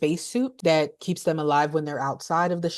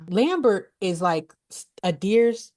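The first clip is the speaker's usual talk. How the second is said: normal talking voice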